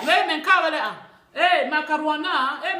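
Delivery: loud, high mock phone shouting; imitation de passagers criards. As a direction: shout over the other passengers, hammed-up. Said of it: "L'autre doit crier plus que l'autre". (passagers au téléphone imités, voix forte et aiguë)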